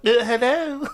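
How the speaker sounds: high put-on Vietnamese-accented greeting; exaggerated fake Vietnamese accent; mock Vietnamese accent. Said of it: "Vietnamese and someone walks in".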